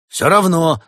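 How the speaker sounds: high, loud outburst; flustered shout. Acted. Badger loses the argument and shouts, flustered. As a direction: cry out in confusion. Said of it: "в замешательстве выкрикнул барсук".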